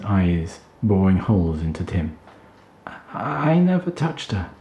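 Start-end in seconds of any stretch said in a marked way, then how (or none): 2.9-4.5 much higher, trembling denial; frightened pleading. Acted. Tim terrified by Kelston, high panicked denial. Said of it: "shaking like a leaf".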